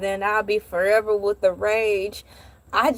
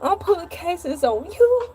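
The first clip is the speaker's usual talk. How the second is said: put-on much higher, tearful child threats; crying angry child; 9 semitones higher than usual. Crying child threatening mom and dad after the scare. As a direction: sob out threats like a wronged little kid. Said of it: "I'm like".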